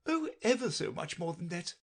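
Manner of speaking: a touch of high moaning lament. A narrator's reading. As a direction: moan it, wringing hands.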